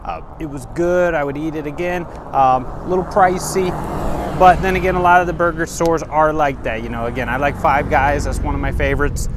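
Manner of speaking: vlog outro. Acted. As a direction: sell it to the viewers. Upbeat outro review wrap-up.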